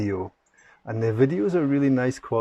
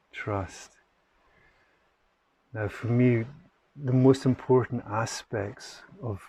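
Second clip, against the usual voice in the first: guided meditation. Acted. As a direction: gently guide listeners into the breath, unhurried.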